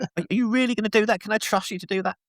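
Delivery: high, doubtful mock wife voice.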